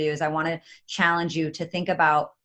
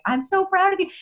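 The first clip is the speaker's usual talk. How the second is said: high, mock-tender husband, much higher; quoting husband, pitched way up and tender; husband's voice; 4 dB louder than usual. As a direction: squeak it out as a proud husband. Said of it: "he was like".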